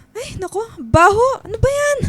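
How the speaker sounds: high, squealing disgust at the smell. Acted. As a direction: recoil from the stink, voice jumping up. Mother smells dog mess.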